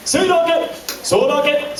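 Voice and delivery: high, bouncy "Sudoku!" cries; playful mock battle cry; silly character shout. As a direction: yell it like a goofy cartoon hero. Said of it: "Something like that".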